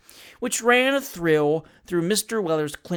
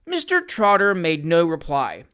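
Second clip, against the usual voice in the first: plain reading voice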